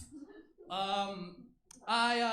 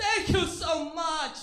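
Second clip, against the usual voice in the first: put-on loud, high, drawn-out African accent; hammed-up grateful newcomer in an African accent; 8 semitones higher, 8 dB louder, much slower than usual. Comic as audiences expect them, put-on African accent. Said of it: "they expect me to come out like".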